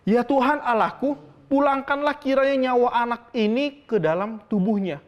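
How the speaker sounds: crying out to God. Retold or imitated. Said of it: "berseru kepada Tuhan".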